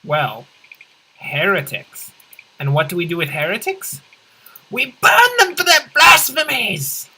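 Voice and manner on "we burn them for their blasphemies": loud, high zealot's yell; hammed-up fire-and-brimstone scream; fanatical cult shout. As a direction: roar it like a crazed cult preacher. Cultist answers own question on heretics.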